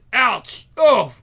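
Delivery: high, loud acted yelp; cry of pain. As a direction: yelp as if just hurt. Character gets hurt in scuffle.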